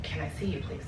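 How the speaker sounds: a quiet, lightly voiced boy begging, falling at the end; soft little-boy plea, mildly imitated; whimpering little boy. A stranger begging at her hotel door, quiet whimpering boy. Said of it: "He sounds like a little boy who's whimpering".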